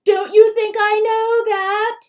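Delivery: a mother losing control; hysterical cry. A narrator's reading. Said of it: "Mom cries hysterically".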